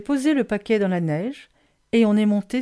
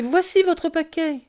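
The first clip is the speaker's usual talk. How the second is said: high-pitched calling out; cried out; 8 semitones higher than usual. A narrator's reading. Child offering the parcel to its owner, called out high. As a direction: hand it over with a raised cry. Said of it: "ai-je crié".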